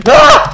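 sudden high loud shriek of surprise; a real startled cry; startled yelp. Real, not acted. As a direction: yelp out at a jump scare. Sudden yelp while gaming.

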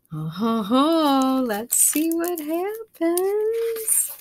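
high, slow teasing; playful excitement. Real, not acted. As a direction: tease the suspense.

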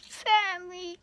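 high, exaggerated lament; mock sadness. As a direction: repeat it as a high-pitched fake whine. Mock lament, repeating the word higher.

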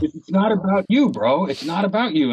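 insistent inner voice. Acted. Inner voice scolds him mid-scene.

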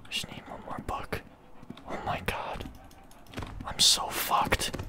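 very soft whisper; hushed despair. Real, not acted. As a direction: whisper so it won't hear. Hiding from listening monster.

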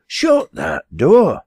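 high, loud, slow command; cried out. Acted. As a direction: call the order sharply across the room. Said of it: "cried the landlady".

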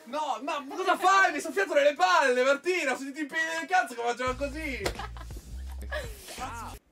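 high strained voice, hurting; in pain. Real, not acted. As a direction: talk through real pain. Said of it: "ho spaccato una mano".

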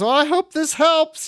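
high-pitched imitation of an amused woman; amused well-wishing. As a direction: retell it high, as if still tickled. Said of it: "is still laughing as he walks off".

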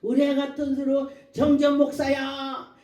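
loud, stretched call by name. Retold or imitated. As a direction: imitate someone hailing a pastor. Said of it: "막 불렀지".

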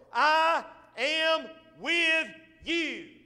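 slow, loud and high, every word drawn out; God's slow booming promise. Acted. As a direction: proclaim it slowly as God. Preacher voicing God.

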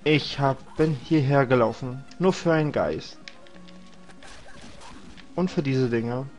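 ordinary talk

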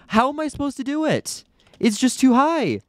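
very high, frustrated wail; exasperated protest. Real, not acted. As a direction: protest in a high, squeaky pitch. Frustrated at failing the jump.

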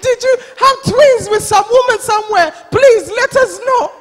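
high, loud, hammed-up interrogation; mock urgent pleading. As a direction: beg for the answer as a nosy elder. Acts elders interrogating the crying pastor.

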